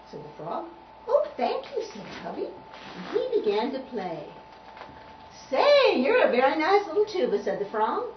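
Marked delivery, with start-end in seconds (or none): 1.1-1.8 delighted thanks. Acted. Delighted tuba thanks frog. 5.5-7.4 high, loud admiring exclamation; impressed praise. Acted. Frog praising Tubby's playing, impressed.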